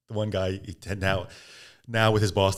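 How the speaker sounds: ordinary delivery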